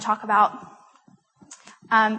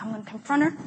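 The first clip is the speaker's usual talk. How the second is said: understated resolve; 5 dB quieter, faster than usual. Retold or imitated. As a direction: say it quickly under the breath. Nervous resolve, first retelling.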